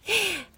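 brief high giggle; short high titter; real giggle. Real, not acted. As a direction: let out a quick amused laugh. Laughs at being a woman cast.

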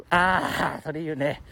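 ordinary talk, nothing marked

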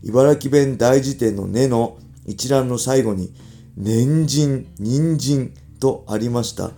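reading a letter aloud. Acted. Radio host reads listener's question on Ibaraki dialect, letter read-out.

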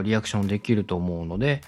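normal talking voice

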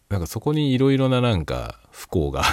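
normal talking voice

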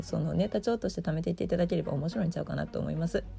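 ordinary talk

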